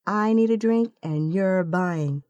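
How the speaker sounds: ordinary delivery, nothing marked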